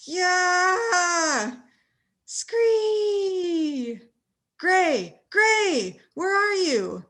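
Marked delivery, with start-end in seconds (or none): 0.1-4.0 rat screech. Acted. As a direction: let out a long rat shriek. Rat noise with shout.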